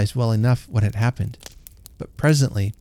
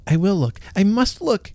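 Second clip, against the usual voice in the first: eager cry; 9 semitones higher than usual. A narrator's reading. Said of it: "cried Hugh".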